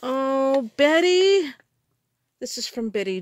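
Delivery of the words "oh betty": high, loud squeal of delight; excited squeal. Real, not acted. Gift mail from Betty, high loud thrilled squeal.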